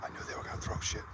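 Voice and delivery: fast excited whisper. Real, not acted. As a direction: rush it out under your breath. Hiding in the woods.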